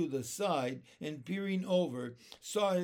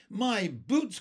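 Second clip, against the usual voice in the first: higher, louder dismayed cry; 5 semitones higher, 7 dB louder than usual. A narrator's reading. Centipede cries out over his soaked boots, high and loud. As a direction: wail over the ruined boots. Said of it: "cried the centipede".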